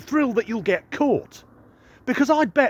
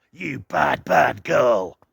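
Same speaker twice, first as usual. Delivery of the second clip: lowered, leering creep voice; creepy pervert voice; 6 semitones lower, much slower than usual. Acted. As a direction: purr it like a sleazy creep. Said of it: "my creepy pervert voice".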